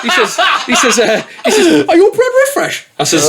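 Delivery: high-pitched giggling through the words; can barely get it out for laughing; laughing while telling. Real, not acted. Laughing while retelling the encounter.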